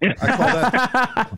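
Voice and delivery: laughing through the words, slow and high; frat bro. Real, not acted. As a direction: chuckle it out, drawn out. Laughing at his own joke.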